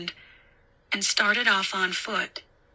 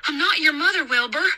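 put-on higher, projected babysitter voice; raised pitch, lifting at the end; calling into darkness; 8 semitones higher than usual. A narrator's reading. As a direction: call out to a frightened child. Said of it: "she called into the darkness".

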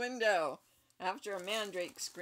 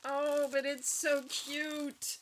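cooing; 5 semitones higher, 4 dB louder, slower than usual. Real, not acted. Cooing over cute creature.

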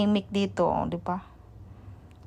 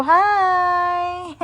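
a bright, much higher sing-song hello; cheery hi; 10 semitones higher, 4 dB louder than usual. Real, not acted. Mother greeting playmate cheerfully.

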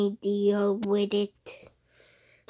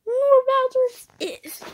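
much higher, louder squeak; high squeaky voice; 7 dB louder than usual. Acted. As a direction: squeak it like a tiny character.

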